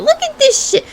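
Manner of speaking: squeaky high disbelief; high outraged squeal. Real, not acted. Vlogger shrieking about the ads on the site. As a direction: shriek it at the screen.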